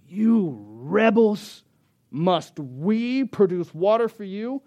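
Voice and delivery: slow, drawn-out loud rebuke; angry Moses. Acted. Preacher voices Moses turning on the people, angry rebuke. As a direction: stretch each accusing word, pitched high and loud. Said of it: "he turns his attention on the people".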